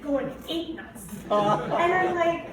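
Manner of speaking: normal talking voice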